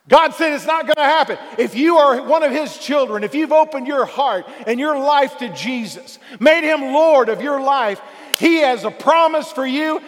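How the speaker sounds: loud, high, building sermon climax; preaching at full force. Real, not acted. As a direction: preach it out, rising and pounding.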